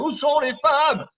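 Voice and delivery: high, loud and slow mock lament; hammed-up high loud cry, drawn out; mock wail. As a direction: wail it like a comic plea to the crowd. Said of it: "où sont les femmes repeated".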